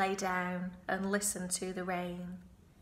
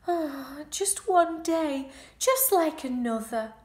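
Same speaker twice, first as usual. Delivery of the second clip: groaning; 6 semitones higher, 4 dB louder than usual. Acted. Wilbur groaning alone in the rainy barn. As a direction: moan it out, feeling sorry for himself. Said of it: "he groaned".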